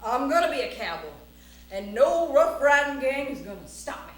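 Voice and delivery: bold cowboy declaration, punched out; defiant vow. Acted. Cowboy vows no gang is gonna stop him, defiant. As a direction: announce it with cowboy bravado.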